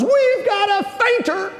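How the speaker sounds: high, drawn-out holler; mock scream. Physician's assistant announces a fainter to inmates, mock screaming. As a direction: imitate a yell to the whole block, very high. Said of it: "screams at the top of his lungs".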